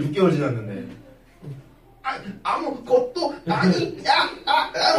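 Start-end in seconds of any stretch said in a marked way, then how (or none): 2.0-5.0 mock tearful denial. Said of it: "막 이래".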